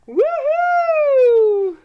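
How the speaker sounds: high, loud whooping shout; joyous cheer. Acted. Celebrating the wolf's surprise party, loud joyous whoop. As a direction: whoop like the party just popped.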